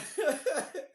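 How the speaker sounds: high-pitched burst of chuckling; laughing. Real, not acted. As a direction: crack up at your own joke. Amused after his own joke.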